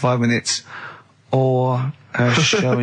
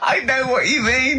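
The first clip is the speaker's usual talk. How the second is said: laughing; 12 semitones higher than usual. Real, not acted.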